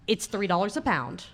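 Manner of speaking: usual speaking voice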